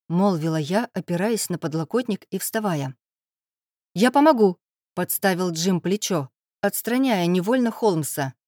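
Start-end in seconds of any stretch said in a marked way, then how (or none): 4.0-4.6 eager, higher and louder offer. A narrator's reading. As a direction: jump in eagerly to help. Jim eagerly offers help.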